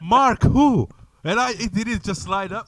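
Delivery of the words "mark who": high, loud baffled question; incredulous. Retold or imitated. Guest answering prank caller Marky, incredulous. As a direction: pitch it way up in disbelief. Retelling his confusion at the prank.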